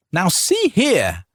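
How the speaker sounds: high, slow indignant yell. A narrator's reading. Wife yelling at the noble ignoring her, enraged. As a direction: draw it out, stamping mad. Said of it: "Carita yelled".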